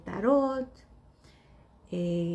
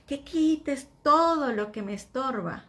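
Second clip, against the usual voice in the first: louder pleading prayer, weight on "todo"; fervent plea; 5 semitones higher, 6 dB louder than usual. Acted. Believer begging the Lord to remove everything, louder plea. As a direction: beg the Lord to take "todo" away, louder.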